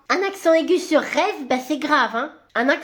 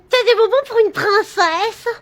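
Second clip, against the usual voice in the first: little princess voice; 4 semitones higher, 4 dB louder than usual. Acted. Child asking for candy.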